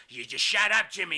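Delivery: acted-out bossy outburst; Kevin Sullivan. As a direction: shut the sidekick down sharply. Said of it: "Kevin Sullivan goes".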